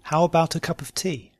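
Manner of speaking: "How about a cup of tea" run together quickly. Shown as a demonstration. Teacher repeating the practice question, quickly.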